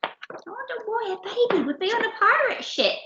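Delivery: high, breathy, hurried wondering; wondering aside. A narrator's reading. Storyteller puzzling with listeners, high and wondering.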